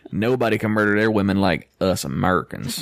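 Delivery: usual speaking voice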